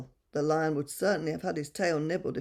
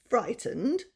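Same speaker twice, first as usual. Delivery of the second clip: haughty lion; 6 semitones higher than usual. Acted. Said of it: "turning up his nose".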